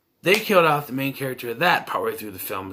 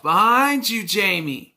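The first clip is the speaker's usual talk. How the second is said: high-pitched, louder imitated warning yell; mock yelling; 8 semitones higher, 3 dB louder, slower than usual. Reviewer imitating a character yelling at himself, high mock yell. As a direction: call it out like yelling at the TV. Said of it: "he's basically yelling at himself".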